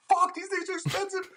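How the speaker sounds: a high shocked squeak on seeing the price; shocked squeal. Retold or imitated. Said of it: "I'm, like, losing my shit".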